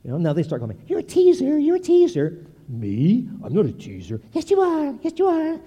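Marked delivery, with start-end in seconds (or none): 0.9-2.4 put-on much higher, louder singsong child voice; granddaughters taunting. Granddaughters teasing Papa back, high singsong. 2.7-4.2 lower, feigned innocence; mock innocent denial. Grandpa pretending innocence to his granddaughters, mock denial. 4.3-5.6 put-on much higher childlike singsong; granddaughters insisting. Granddaughters insisting Papa is a teaser, high singsong.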